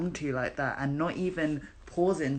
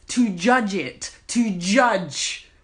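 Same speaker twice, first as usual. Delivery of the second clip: exasperated emphasis; 2 semitones higher, 6 dB louder, much slower than usual. Real, not acted. Outraged rant about judging drag.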